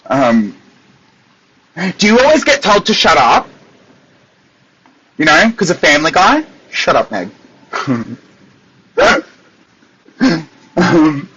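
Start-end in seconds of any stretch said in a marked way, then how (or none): none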